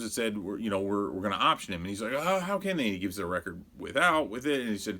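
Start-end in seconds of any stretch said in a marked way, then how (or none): 2.1-2.8 mock complaint. Mimics Rogers' complaining.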